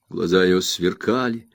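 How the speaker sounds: plain reading voice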